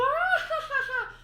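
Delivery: shrieking falsetto giggle; high ticklish laugh. Acted. Clown being tickled, high shrieking laugh.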